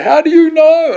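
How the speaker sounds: loud, high-pitched cry of disbelief; imitated anguished outburst; distraught challenge. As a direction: yell it out desperately. Distraught waiter challenges him, retold.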